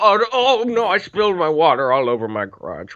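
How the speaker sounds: put-on high shaky old woman. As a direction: play a frail old woman. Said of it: "ninety-year-old woman".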